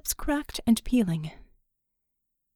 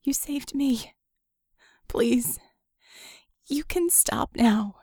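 weak plea; 3 semitones higher, slower than usual. Acted. Vicky pleading weakly after rescue.

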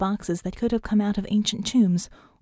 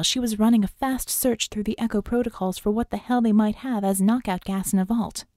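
usual speaking voice

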